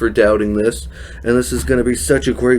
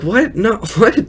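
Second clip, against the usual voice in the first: shocked disbelief; 8 semitones higher than usual. Retold or imitated. John Heder's shocked reaction quoted.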